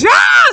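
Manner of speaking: short, loud high-pitched scream. Retold or imitated. Acting out the big athlete screaming again, loud high scream. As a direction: imitate a sudden piercing shriek. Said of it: "screams again".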